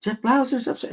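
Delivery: high-pitched fake Cuban accent; hammed-up Cuban-accented messenger; bad Spanish accent. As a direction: announce it high in a badly faked Spanish accent. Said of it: "I wish I could do a Spanish voice".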